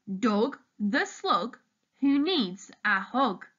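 usual speaking voice

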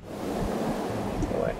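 slow eerie whisper of "Go away", breathy and drawn out. Pretend. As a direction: hiss "Go away" slowly like a ghost. Telling the creature to leave.

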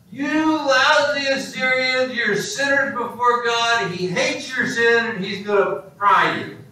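high, drawn-out pulpit tirade; angry fire-and-brimstone preacher. Acted. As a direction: thunder judgment at the congregation. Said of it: "the most angry, pew-heating sermon".

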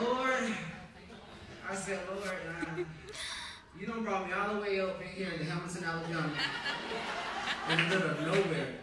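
laughing, loud mock complaint to God; half-joking outcry at God; exasperated prayer. Retells his own frustrated prayer.

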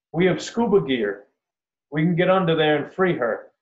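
loud, high call across water. A narrator's reading. Boater offers the crowd help for the deer, calling out. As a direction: holler it out to carry. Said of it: "cups his mouth".

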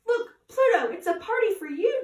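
high, loud, delighted calling out; excited cry. A narrator's reading. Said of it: "Dory cried".

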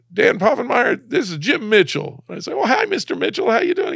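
high, tight voice on the edge of tears; fighting back tears while retelling the call; choked up. Real, not acted. Guest retelling his teacher's call, voice near tears.

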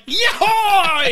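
high hammed-up cowboy whoop; imitating a rider's high yeehaw as he jumps; mock yeehaw. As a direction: whoop it out like a rider leaping a horse. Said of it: "he yeehaws out of nowhere".

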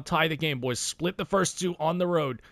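usual speaking voice

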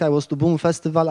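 ordinary talk

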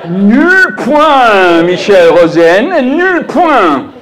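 high, loud French scoring; mock French jury voice, high and loud; French Eurovision jury. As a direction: announce the zero like a Eurovision jury. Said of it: "British entry in Eurovision".

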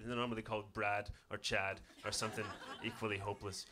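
ordinary talk

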